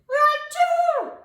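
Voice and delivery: loud, high explosive achoo; sneeze. A narrator's reading. Arnold sneezing in the pollen tube, loud high sneeze. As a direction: burst out a big sneeze.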